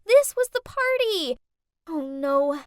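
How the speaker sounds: high, loud gasp of realisation; horrified realization. Acted. Girl realises her mom is the maid at the party, horrified. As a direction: it hits her all at once.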